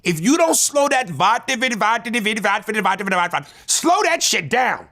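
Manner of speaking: worked-up, stretched-out complaint in a high voice; exasperated retold demand. Acted exasperated demand to slow down.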